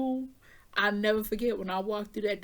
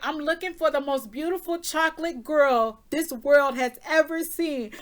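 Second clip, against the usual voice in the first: proud mother announcing; 5 semitones higher, 4 dB louder than usual. Retold or imitated. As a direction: imitate mom calling out, raised pitch. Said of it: "And she was like".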